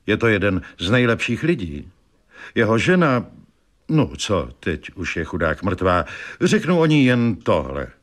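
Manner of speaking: ordinary delivery, nothing marked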